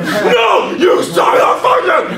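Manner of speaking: high, loud, slow yelled curse; high screaming self-argument; mock inner fight. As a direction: fight yourself at the top of your lungs.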